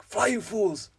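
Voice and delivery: high, urgent Gandalf shout; Gandalf cry. Retold or imitated. Fan imitating Gandalf's desperate cry to the fellowship. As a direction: fling the warning out like a wizard falling. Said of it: "just the way he says that".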